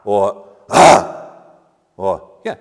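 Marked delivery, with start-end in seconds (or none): 0.7-1.1 loud, high "ah"; gasp of wonder and fear. Acted. Preacher trying readings of the demon's cry, startled "ah". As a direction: blurt a startled "ah". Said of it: "a note of wonder and fear".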